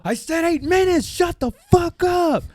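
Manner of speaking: high, strained retold outburst; mock angry yell. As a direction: snap it out high, then drag the last words.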